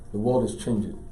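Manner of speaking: usual speaking voice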